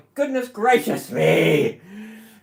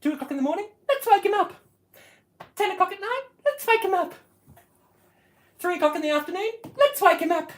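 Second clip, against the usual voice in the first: mocking the neighbours; 9 semitones higher, much faster than usual.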